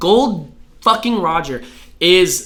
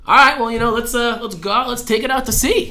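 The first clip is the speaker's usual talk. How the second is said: high-pitched, eager retold pitch; eager proposal; 7 semitones higher than usual. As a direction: imitate someone casually talking a stranger into adventure. Acting out Roger's boat proposal.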